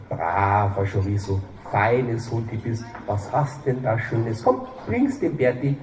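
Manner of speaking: slow, soft coaxing to a dog. Acted. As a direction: lure the dog sweetly.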